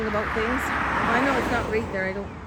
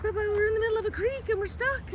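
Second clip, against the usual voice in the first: high, fast mock panic; panicky tourist; 12 semitones higher than usual. Canadian tourist stuck in the creek, high panicked squeak. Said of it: "He's like".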